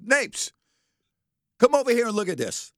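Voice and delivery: urgent call from the garage; roommate calling out. Retold or imitated. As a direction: yell for your buddy to come see. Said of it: "he goes".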